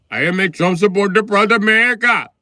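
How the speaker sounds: high, loud put-on accent voice; hammed-up proud immigrant voice; mock Indian immigrant. Comic mimicking an audience member, loud put-on accent.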